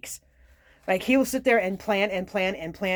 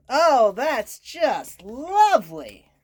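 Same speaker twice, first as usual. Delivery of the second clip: slow, swooping mock delight; sarcastic; 4 semitones higher, 5 dB louder, much slower than usual. Real, not acted. Reader reacting to the Oedipus card, slow and sarcastic. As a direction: draw it out high and loud, dripping with irony.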